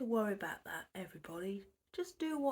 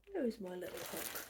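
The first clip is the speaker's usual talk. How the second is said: usual speaking voice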